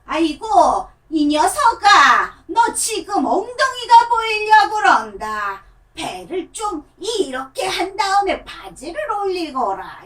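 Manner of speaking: put-on a fussing grandma nagging a child; grandma puppet telling a child to pull up his pants. Shown as a demonstration. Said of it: "할머니로 할게요".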